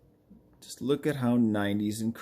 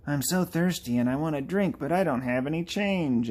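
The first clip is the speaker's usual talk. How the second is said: higher, singsong plea; lightly whiny put-on character voice; thirsty game character; 5 semitones higher than usual. Game character hinting for a drink, higher pleading voice.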